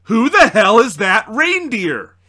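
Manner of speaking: high, loud, slow yell; shouting. Acted. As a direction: bellow it in alarm. Shocked character shouting.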